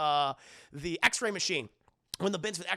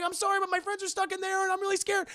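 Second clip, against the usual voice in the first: much higher, whiny frightened voice; mock scared plea; 13 semitones higher, 5 dB louder than usual. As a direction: beg like a scared kid. Peter pleading to police.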